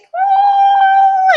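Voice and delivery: high, loud, drawn-out joyous ooh; joyful squeal. Real, not acted. Reader shows joy felt in the heart, squealing. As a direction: let joy burst out in a long high squeal. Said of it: "like, joy, like".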